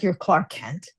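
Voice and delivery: dry, understated skeptical echo; acted doubtful superior; unimpressed disbelief. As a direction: repeat the cover name with no excitement. Acted superior doubting the cover name.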